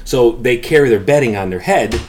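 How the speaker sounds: ordinary talk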